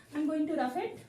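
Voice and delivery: usual speaking voice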